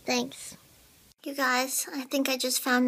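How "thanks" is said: normal talking voice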